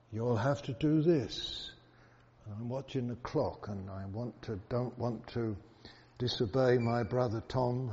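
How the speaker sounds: ordinary talk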